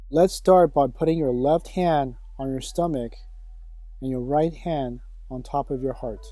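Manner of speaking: hushed, unhurried breathing cues; guided sleep meditation. Acted. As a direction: guide them gently into sleep, leaving room for each breath.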